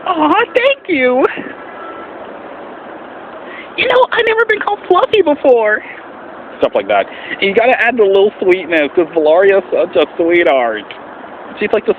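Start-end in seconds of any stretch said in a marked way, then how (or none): 0.1-5.8 sweet plush dragon. Acted. Thanking viewers as the plush dragon, very high and sweet. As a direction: coo like a cuddly toy dragon. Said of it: "it has to be up here".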